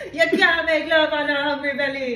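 playful UK-tinged patois; Irish-tinged patois. Shown as a demonstration. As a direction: overdo a Jamaican accent that slips into Irish. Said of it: "It's, like, Irish".

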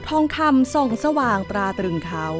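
plain reading voice

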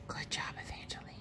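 soft whisper of praise. Real, not acted. Mother whispering praise to her calming daughter. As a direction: whisper it gently so the moment stays calm.

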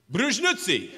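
fake Russian again. Same faked Russian answer.

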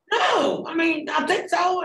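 startled answer. Retold or imitated. Just revived, answering the paramedics in a high startled shout. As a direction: fire it back high and rattled.

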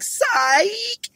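very high squeaky sing-song psych; kid's teasing taunt, high; playground taunt. Retold or imitated. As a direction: squeal it like a mocking kid. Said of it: "He'd be like".

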